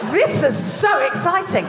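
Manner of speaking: mock excited gasp. Mock thrill about lost family.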